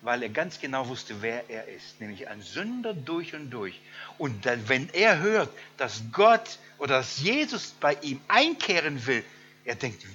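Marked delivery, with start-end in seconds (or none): none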